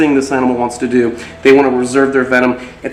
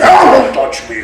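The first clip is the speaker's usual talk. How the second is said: "Ah, don't touch me" higher and louder, a hammed-up snake cry; voicing the snake; 10 semitones higher, 6 dB louder than usual. Keeper hams up the snake's warning, higher and louder. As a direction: push "don't touch me" up into a mock yelp. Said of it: "Doesn't want to be messed with".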